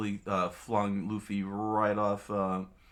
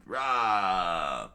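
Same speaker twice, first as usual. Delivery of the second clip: drawn-out battle yell at middling volume; half-hearted roaring war cry; mock scream; 3 dB louder than usual. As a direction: let out a playful charging roar.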